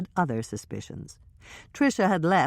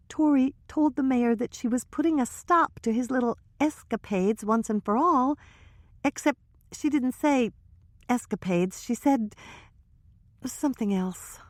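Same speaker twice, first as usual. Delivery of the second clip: put-on higher young woman's voice, hushed gossip; Trisha's voice; 10 semitones higher than usual. Trisha confiding to Hannah and Michelle, higher nervous voice. Trisha, embarrassed, retells call.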